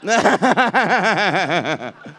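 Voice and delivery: long rattling cackle. Real, not acted. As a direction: cackle in a long pulsing run. Performer's own laugh after joke.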